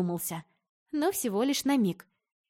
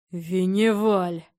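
louder, slow, spat-out name; spat out like a curse; 7 dB louder, much slower than usual. A narrator's reading. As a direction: spit the name like a swear word. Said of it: "выплюнул как ругательство отец".

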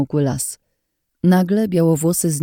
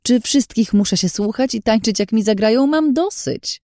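high, heated venting; fed-up rant climbing higher at the end; angry outburst; 8 semitones higher than usual. A narrator's reading. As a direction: let all the frustration burst out. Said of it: "wybuchłam, wyładowując na niej swój zawód i gniew".

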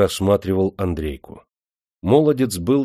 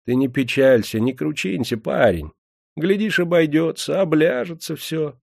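higher, gentle soothing coax; coaxing cook; 7 semitones higher than usual. A narrator's reading. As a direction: comfort the grieving boy. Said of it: "уговаривала его стрепуха".

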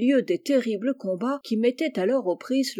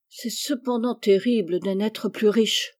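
usual speaking voice

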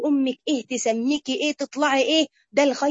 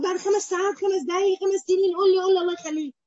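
higher-pitched, lightly acted begging; imitated tearful pleading; 5 semitones higher than usual. Dreamer begs Ibn Sirin for an answer, imitated tearful pleading. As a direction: plead as if tearful, without real sobbing. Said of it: "قعد تعيط وفتح لايده خمسه".

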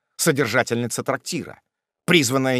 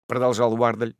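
usual speaking voice

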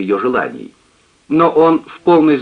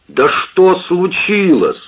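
slow, louder, higher enraged cry; furious shout; 9 semitones higher, 4 dB louder, much slower than usual. A narrator's reading. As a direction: explode with frustration. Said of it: "закричал взбешённый Джонс".

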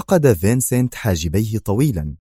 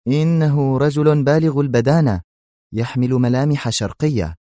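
higher, louder, puzzled; surprised report; 5 semitones higher, 4 dB louder than usual. Acted. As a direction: tell him who's at the door. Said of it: "وغمغم في دهشة".

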